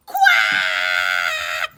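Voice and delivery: loud low squawking quack; angry duck quack. Acted. Missus Duck squawking at the wolf, loud quack. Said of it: "she cried".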